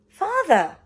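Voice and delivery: loud high call; the boy calling to his unresponsive father. Acted. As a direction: call out to him. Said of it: "called out the boy".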